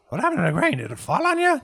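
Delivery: high, loud worried questions; alarmed innkeeper. Acted. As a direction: fret over the boy. Innkeeper alarmed about the grain.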